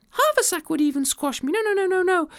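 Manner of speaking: very high, flustered refusal; too small for such a gift; squeaky modest ant; modest tiny ant. Acted.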